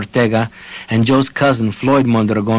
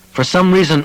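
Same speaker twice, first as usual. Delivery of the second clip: plain reading voice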